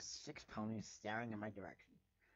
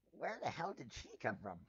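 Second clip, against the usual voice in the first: usual speaking voice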